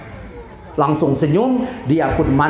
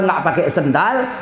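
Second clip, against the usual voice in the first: usual speaking voice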